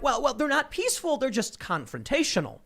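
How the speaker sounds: high-pitched imitated hedging; mock excuse. Newspaper excusing the riots, mocked in a high voice. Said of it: "And so they're like".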